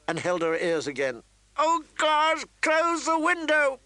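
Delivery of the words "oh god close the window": very high, loud desperate scream. Acted. Catelyn screaming at Robb to shut out the howls. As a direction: shriek the order at him. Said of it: "She screamed".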